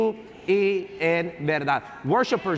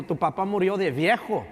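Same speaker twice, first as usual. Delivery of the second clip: usual speaking voice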